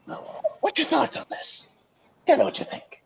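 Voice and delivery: squeaky hushed prodding; high whisper. Acted. Whispered question to guest.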